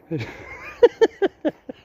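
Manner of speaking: a sudden high-pitched giggle. Real, not acted. Vlogger laughing at his own joke, a high giggle.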